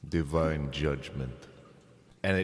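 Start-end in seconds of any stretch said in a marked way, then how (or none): 0.1-1.3 solemn god voice. Acted. God character answers solemnly.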